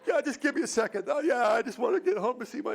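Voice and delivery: hammed-up crying businessman at the airport; a grown man pretending to break down over a canceled flight; mock blubbering. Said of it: "that's the more honest emotion".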